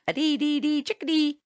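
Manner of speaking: high, chirpy bird song; chickadee call. A narrator's reading. Tommy breaks into his cheerful chickadee call. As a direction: sing it like a little bird.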